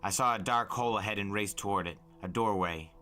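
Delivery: plain reading voice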